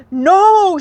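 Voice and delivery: high, loud no; shout. Acted. Hickory to Pecan, shouting no. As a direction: shout back fiercely. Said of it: "shouted Hickory".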